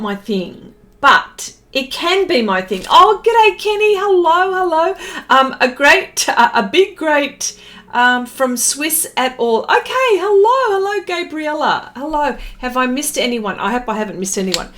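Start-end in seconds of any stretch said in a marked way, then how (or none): 2.9-4.9 cheery greeting. Real, not acted. Greets viewer in live chat. 9.6-12.4 cheery high hello. Real, not acted. Greets viewer in live chat.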